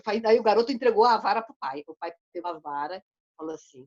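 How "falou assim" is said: normal talking voice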